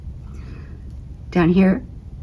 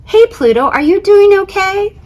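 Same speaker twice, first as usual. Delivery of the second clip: high, loud friendly call; calling out to Pluto; 14 semitones higher, 6 dB louder than usual. Acted. Planets voiced in picture book.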